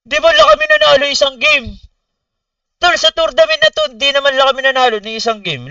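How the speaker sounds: high, loud disbelief at losing every game; a real burst of stunned frustration; incredulous outburst. Real, not acted. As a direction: shout it in shocked outrage.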